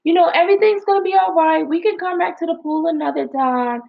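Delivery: very high, sugary soothing mom voice; calm the screaming kid sweetly for the onlookers; public figure mom. Retold or imitated. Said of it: "And I'm like".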